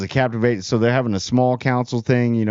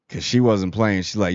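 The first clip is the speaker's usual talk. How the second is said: usual speaking voice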